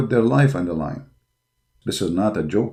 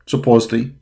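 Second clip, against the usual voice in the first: normal talking voice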